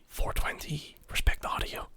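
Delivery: whispered like a ghostly voice; ghostly mock whisper; haunted whisper. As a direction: hiss it like a haunting memory. Haunting phrase in his head.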